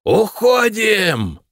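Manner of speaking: high, very slow drawn-out yell. Acted. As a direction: stretch the cry across the battlefield. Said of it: "орал Айсын".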